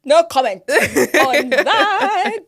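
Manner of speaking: very loud, high-pitched no comment; playful shouted refusal with laughter; emphatic refusal. Real, not acted.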